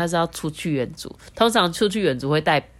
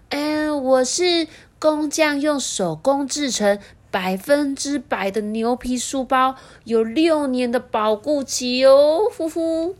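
higher, slow self-satisfied boast; proud bragging bag; 8 semitones higher, slower than usual. Acted. The schoolbag boasting about its leather to the mother, smug. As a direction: show off, pleased with yourself.

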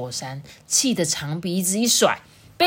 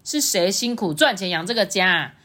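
angry dad raising his voice; 生气大吼; 5 semitones higher, 5 dB louder than usual. A narrator's reading. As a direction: roar back at your wife, climbing in pitch. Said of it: "就对着象妈大吼说". (爸爸发火吼妈妈)

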